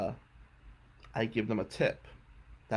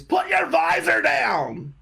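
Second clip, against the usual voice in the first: high, loud, drawn-out yell; screamed order; 6 dB louder, slower than usual. Retold or imitated. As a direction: imitate a mechanic screaming an order. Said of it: "this one guy screams".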